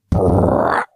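loud blown raspberry; imitated fart noise. Storyteller mimics girlfriend's fart on dog walk, loud raspberry. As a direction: blow a loud, abrupt mock fart. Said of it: "I hear this, like".

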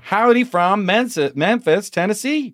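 put-on Southern accent. Host reading email aloud in Southern accent. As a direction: read the listener email in a Southern belle voice. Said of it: "should I do the accent".

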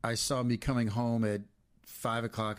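usual speaking voice

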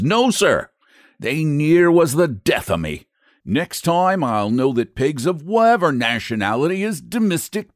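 gruff Irish brogue, snorting with anger; an irritated Irish expressman grumbling; Irish accent. Acted. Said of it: "my dreadful Irish accent".

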